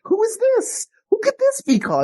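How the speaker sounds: very high-pitched, excited phone answer. Retold or imitated. Caller surprised by an unexpected phone call, excited. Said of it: "I was so excited to".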